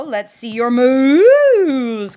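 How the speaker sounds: loud call with a long cow-like "moo" in moves. Acted. Pig urging Cow to dive, loud drawn-out call. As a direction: stretch the last word high and loud. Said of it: "called Pig".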